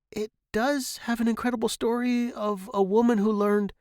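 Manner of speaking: put-on high, earnest kid voice; anxious high-pitched boy explaining; eager boy. A narrator's reading. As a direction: plead the book's case like a young son. Boy pleads for book, very high.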